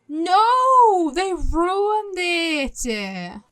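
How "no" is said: dismayed shout. Real, not acted. Reward ruined by two laps.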